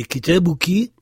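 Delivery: "kitabuki" ending in a crisp i; feminine form pronounced. Shown as a demonstration. Teacher showing the feminine ending, crisp final i. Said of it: "fille, euh, femme".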